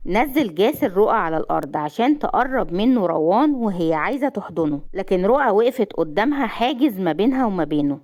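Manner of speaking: plain reading voice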